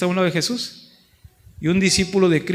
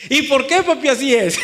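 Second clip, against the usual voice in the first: higher, mock little-kid whine, laughing; pestering little child; 7 semitones higher, 5 dB louder than usual. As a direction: nag dad like a toddler who won't stop asking. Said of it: "cuándo empiezan a preguntar el por qué los niños". (niño preguntón imitado, voz más aguda)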